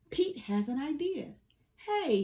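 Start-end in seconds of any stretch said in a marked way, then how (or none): 1.8-2.2 mild shout. A narrator's reading. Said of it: "he shouts".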